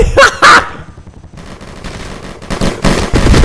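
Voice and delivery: high-pitched burst of mock-evil laughter; villain cackle. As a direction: cackle over the gunfight. Villain gloating during gunfight.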